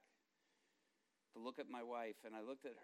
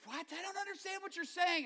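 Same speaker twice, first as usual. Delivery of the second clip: high-pitched, raised voice; baffled protest; 5 dB louder than usual. Retold or imitated. Wife to babbling husband on the walk, baffled high protest. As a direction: protest in a much higher voice.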